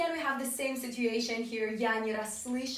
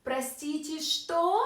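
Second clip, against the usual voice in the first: "что" goes up higher than "простите"; rising question; 4 semitones higher than usual. Shown as a demonstration. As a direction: let the voice climb on "что". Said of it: "goes up a little bit".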